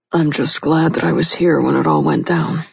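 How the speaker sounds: gruff male voice; low gruff voice. A narrator's reading. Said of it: "he said in a low, gruff voice".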